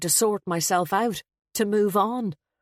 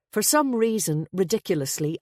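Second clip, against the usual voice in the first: ordinary delivery, nothing marked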